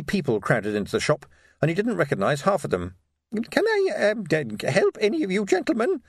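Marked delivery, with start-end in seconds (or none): 3.5-6.0 put-on high, hesitant little old lady; thin querulous voice. Tiny shopkeeper addressing crowd of armed men, querulous. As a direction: falter thinly in a high old voice. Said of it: "said a thin, querulous little voice".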